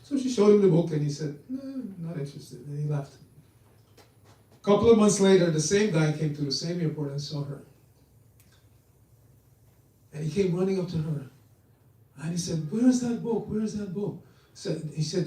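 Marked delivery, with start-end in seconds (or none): 1.5-2.7 higher, softly tossed-off brush-off; mock offhand no; dismissive refusal. Dismissive traveller refusing the book. 12.7-14.2 high-pitched, eager demand, acted out; pleading urgency in a raised pitch; urgent questioning. Man runs up, urgently seeking book.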